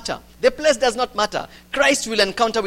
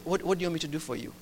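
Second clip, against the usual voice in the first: quick soft question; 4 semitones lower, 10 dB quieter, much faster than usual. Acted. Jesus' calm question after the imagined retort.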